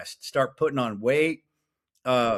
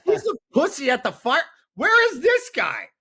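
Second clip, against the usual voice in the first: much higher, louder taunting voice; mocking coworker; 14 semitones higher, 5 dB louder than usual. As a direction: squeak it out like a jeering firehouse guy. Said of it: "He's like".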